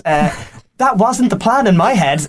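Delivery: ordinary talk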